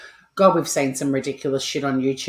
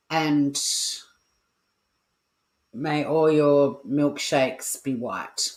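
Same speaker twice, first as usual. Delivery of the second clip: slow punchline; much slower than usual. Real, not acted. Jokey sign-off line.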